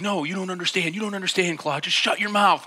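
high-pitched, rattled retort in a retold quarrel; furious, fear-struck. Fear-struck driver to back-seat friend, mock furious. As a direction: imitate a panicking friend snapping at you. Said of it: "he's furious".

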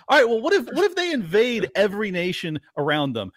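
ordinary delivery

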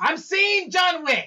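high, drawn-out indignant yell; protesting shout. Real, not acted. Answering a friend about movies seen, protesting shout. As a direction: holler it back, stretching the words.

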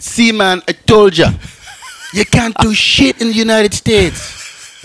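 high, hammed-up islander crowing to a friend; imitated Bahamas local. Bahamas local proving a point to a friend, high imitated crowing. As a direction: put on a triumphant high local voice, drawn out at the end. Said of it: "he's like".

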